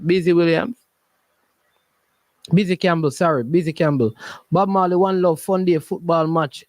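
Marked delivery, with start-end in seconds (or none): none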